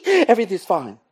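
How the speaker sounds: high, chirpy forced cheer; strained mock brightness; fake fine. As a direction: insist all is fine through a laugh. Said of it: "So they go".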